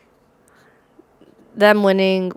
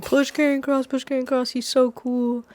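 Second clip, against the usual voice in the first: higher, softer mock fan chant; mocking fanboys; 4 semitones higher, 6 dB quieter than usual. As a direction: parrot gushing fans under your breath. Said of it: "y'all are grown men saying".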